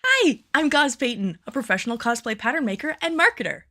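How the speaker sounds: bright upbeat host read; cheerful on-camera presenter voice; presenter intro read. Acted. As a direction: sell the intro like a YouTube host. Final take of the intro.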